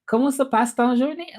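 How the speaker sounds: high, confident Comment se passe ton journée; proud French. Shown as a demonstration. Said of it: "I said it right".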